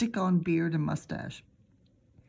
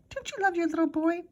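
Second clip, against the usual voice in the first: put-on higher, wheedling little boy voice; pleading little boy; 11 semitones higher than usual. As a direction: charm her with sweetness. Fudge sweet-talking Mom.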